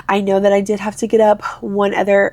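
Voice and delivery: ordinary talk, nothing marked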